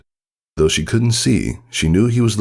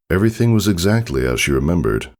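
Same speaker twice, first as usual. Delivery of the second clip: plain reading voice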